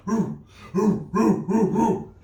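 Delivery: short, rhythmic hoots; punchy rhythmic mock barking; chanted dog woofs. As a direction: bark out the song hook playfully. Song hook's dog barks.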